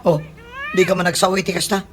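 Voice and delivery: surprised. Acted. Surprised exclamation in skit.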